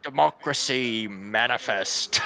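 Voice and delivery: slow, loud theatrical echo; booming imitation of a pompous orator; mock proclamation. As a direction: declaim it grandly, stretching each word. Echoing the arrested man's proclamation.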